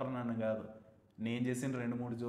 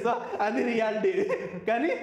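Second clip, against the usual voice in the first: laughing it off, higher and louder; 8 semitones higher, 5 dB louder than usual. Real, not acted. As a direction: chuckle through the words. Laughing about family taking house. (నవ్వుతూ చెప్పడం)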